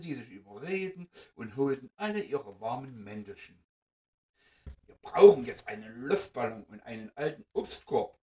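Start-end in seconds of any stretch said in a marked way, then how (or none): 4.9-8.2 deutlich lauter gerufen; laut in die Runde rufen. Acted. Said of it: "rief die Fee". (Fee ruft den Mäusen zu, deutlich lauter)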